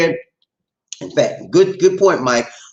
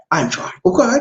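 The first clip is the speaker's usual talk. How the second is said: normal talking voice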